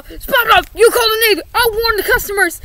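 ordinary delivery

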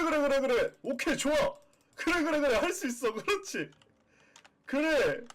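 high, loud cheering on; excited cheering. Real, not acted. Streamer cheering the puppy as it makes a mess.

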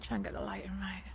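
muttered whisper, almost no voice; whispering to herself. Real, not acted. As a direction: whisper it to yourself while setting up. Talking to herself while filming.

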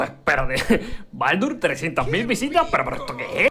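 startled disbelief, laughing a little; mock shock at the numbers; a touch of amazed panic; retold surprise. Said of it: "y dijimos".

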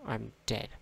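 normal talking voice

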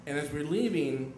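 normal talking voice

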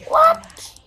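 very high sudden squeak; shocked squeal. Real, not acted. Gamer squeaking in shock at a play, very high. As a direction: yelp it in disbelief.

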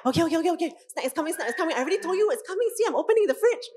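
flustered parent rushing to please; rushing panic. Retold or imitated. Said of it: "Our voice starts going up".

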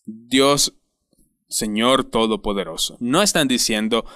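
usual speaking voice

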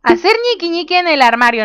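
high, incredulous read-out; startled reading. Real, not acted. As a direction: blurt it out in amused disbelief. Startled misreading of game option.

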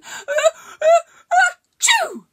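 a huge squealing high sneeze building up; loud sudden sneeze. Acted. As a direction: build the ah-ah-ah then explode into chew. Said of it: "a loud and sudden".

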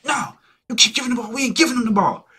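loud, high-pitched mad protest; imitated angry yelling. Teammate mad over giving away the ball, imitated yelling. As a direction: mimic a mad teammate's yell. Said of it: "you not finna be yelling at me".

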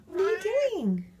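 high sing-out that drops at the end; cooing pet talk. Real, not acted. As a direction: coo a high question at the cat.

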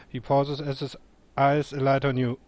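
ordinary talk, nothing marked

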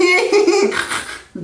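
high nerdy snort-laugh; imitated high-pitched sitcom-nerd giggle; Steve Urkel. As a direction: squeal out a goofy nerd laugh. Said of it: "It's like".

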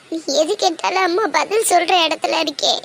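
normal talking voice

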